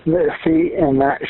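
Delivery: usual speaking voice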